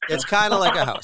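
high, loud jokey echo; mock repeat. Real, not acted. Echoing a friend's line in a high goofy voice. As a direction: throw the line back in a silly voice. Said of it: "laughs Right".

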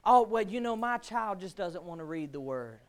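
low, subdued imitation of an excusing parent; mock excuse. Preacher mimicking parent excusing a child, subdued mock excuse. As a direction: drop down into a meek mock excuse.

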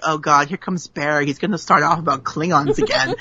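low, quick groaning aside; weary dread. Acted. Party guest sees Barry coming, weary dread. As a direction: dread him under your voice.

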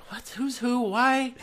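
high, squeaky confused questions; confused squeak. Retold or imitated. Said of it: "I get impatient when, like".

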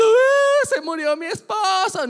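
high, loud mock wailing wife; a jokey, overdone mock lament; bad imitation of Pacquiao's wife. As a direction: ham up a crying wife badly. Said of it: "fue mala imitación filipino".

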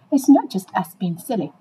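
normal talking voice

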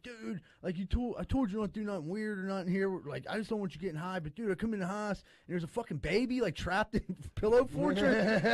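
a hint of the baffled roommate, laughing at the end.

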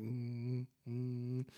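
soft imitated soothing hum; calming hum. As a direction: hum it like a mother settling a child. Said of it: "that always calms me down".

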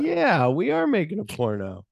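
high-pitched put-on agreement; exaggerated high "oh yeah" reply; mock playing along. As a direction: act out going along with it, voice lifted. Acted reply, playing along with him.

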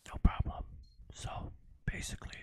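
whispered reply into mic; whispering. Pretend. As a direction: drop to a breathy whisper. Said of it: "you have to whisper into the mic then".

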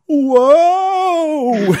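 high, loud hammed-up whoa; loud high mock cry of losing balance. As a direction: overplay a falling yelp. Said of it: "he loses balance and goes".

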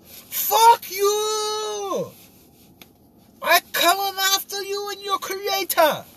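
very high, hammed-up mock-Russian yell; botched Russian accent. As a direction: threaten in a wildly off Russian accent. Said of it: "giving him the wrong accent".

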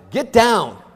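loud retold shout; angry man shouting at his child. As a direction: bark the order at the crying kid. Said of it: "an angry man just shouted".

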